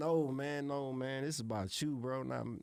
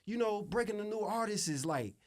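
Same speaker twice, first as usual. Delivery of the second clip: higher mock voice of a persuading exec; mimicking the label exec; 9 semitones higher than usual. Producer mimicking the exec pitching a new girl group, higher. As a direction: play the smooth label guy, pitched up. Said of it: "He's like".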